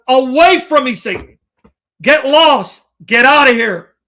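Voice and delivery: loud, punchy mock dismissal; fed-up rebuke. Preacher voicing Jesus sending Satan away, fed-up loud rebuke. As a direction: act out ordering someone away, with force. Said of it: "When Jesus gets fed up with Satan".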